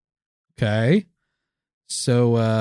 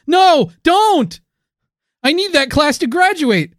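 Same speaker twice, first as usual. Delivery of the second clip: high-pitched mock-desperate plea; panicked pleading; 12 semitones higher, 4 dB louder, slower than usual. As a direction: beg frantically, voice jumping up. Pleading not to be failed.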